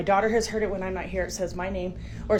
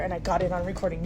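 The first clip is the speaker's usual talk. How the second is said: ordinary talk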